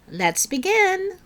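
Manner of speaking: plain reading voice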